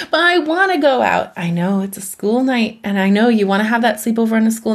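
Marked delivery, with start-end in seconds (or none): 0.1-1.3 put-on high, pleading teenager voice; mother voicing her teen's whine; whiny teen. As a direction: beg like a thirteen-year-old. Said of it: "a thirteen-year-old who's like".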